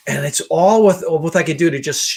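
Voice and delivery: ordinary delivery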